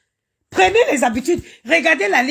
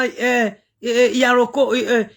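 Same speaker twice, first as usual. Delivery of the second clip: ordinary delivery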